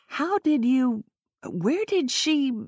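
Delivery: stunned stammer. A narrator's reading. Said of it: "Hazel found forming a complete thought difficult".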